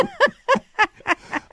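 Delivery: high, mocking demonic cackle; a possessed woman's cackle mid-sentence; devil cackle. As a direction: let out a gleeful devil's laugh. Reader voices the devil laughing.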